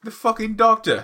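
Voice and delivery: hammed-up British outburst; terrible British accent. Jokey podcast host mocks in a bad British accent. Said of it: "my terrible British accent".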